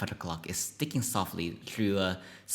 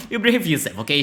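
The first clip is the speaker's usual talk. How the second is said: lightly voiced mom, pitched higher; a hint of a mom, louder and higher; mom voice attempt; 7 semitones higher, 7 dB louder, faster than usual. Mom to kid from the car, lightly acted mom voice. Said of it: "she says, as always".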